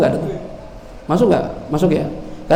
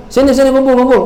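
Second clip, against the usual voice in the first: calling crowd together; 8 semitones higher, 4 dB louder than usual. Acted. Umar summons the crowd.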